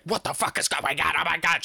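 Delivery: mock panicked babble; imitated freak-out. Devin hearing trouble over the phone, mock freaking out. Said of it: "She's freaking out".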